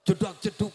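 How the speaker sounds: loud vocal imitation of a thumping club beat; a quick burst of mouth-made bass thumps; mock disco beat. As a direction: thump it out like dance music at a nightclub.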